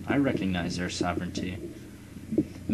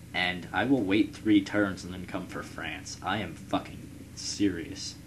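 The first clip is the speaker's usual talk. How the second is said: ordinary talk, nothing marked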